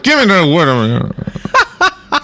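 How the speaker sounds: laughing mock demand; mock squabble. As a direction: ham up a fight through laughter. Acting out a fight over wood.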